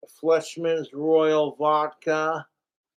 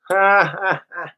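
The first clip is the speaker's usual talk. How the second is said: real laugh; 2 semitones higher, 4 dB louder than usual. Real, not acted. Streamer cracks up at the eggplant sent by trolls, loud real laugh. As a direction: let a real laugh burst out.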